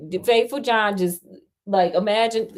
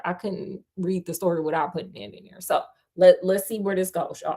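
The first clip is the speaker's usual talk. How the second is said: ordinary delivery, nothing marked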